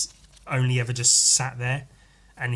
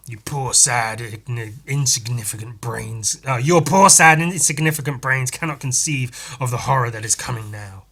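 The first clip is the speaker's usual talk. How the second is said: gloating monster; 7 dB louder than usual. Acted. Monster threatening humans, loud and menacing. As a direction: taunt puny humans with doom.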